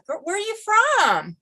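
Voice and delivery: high, slow, puzzled asking; curious stranger. Retold or imitated. Imitating a stranger who asks where she is from, high and slow. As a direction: imitate a stranger's pointed question. Stranger quizzing her about accent.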